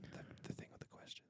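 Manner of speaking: breathy whisper. Real, not acted. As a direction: whisper it aside. Whispered aside.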